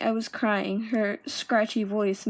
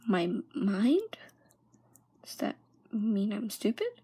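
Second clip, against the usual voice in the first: hurt whisper. Retold or imitated. Girl echoes her teacher's remark, in a stunned whisper. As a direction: stumble over it in a wounded whisper.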